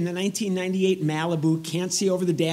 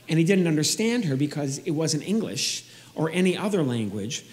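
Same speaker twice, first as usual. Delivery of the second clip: normal talking voice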